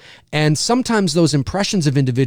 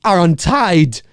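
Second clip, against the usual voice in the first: very slow, louder, odd Walken pauses; Christopher Walken; 3 dB louder, much slower than usual. Shown as a demonstration. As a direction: stretch it out in Walken's strange rhythm. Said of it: "not an impression of Walken".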